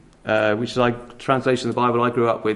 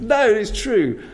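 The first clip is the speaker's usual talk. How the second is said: sharply higher, blunt prophet; Amos's retort; 8 semitones higher, slower than usual. Retold or imitated. Amos refusing to tone down his words, blunt and high. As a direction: flatly refuse to soften it.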